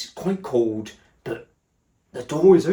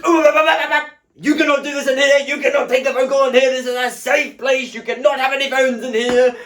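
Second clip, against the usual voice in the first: much higher, louder mock voice, rattling fast; mocking the stylist; 12 semitones higher, 6 dB louder, faster than usual. Mocking the stylist banning phone calls, high loud mock voice. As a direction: parody a bossy stylist laying down rules.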